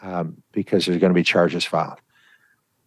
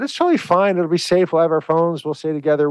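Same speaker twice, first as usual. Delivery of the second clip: put-on much higher, breezy teen reassurance; pleading teen; 8 semitones higher than usual. Daughter reassures mom about Cancun, high breezy pleading. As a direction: brush off worries like a carefree teen. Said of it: "her daughter said".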